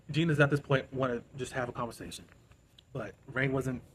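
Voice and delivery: ordinary delivery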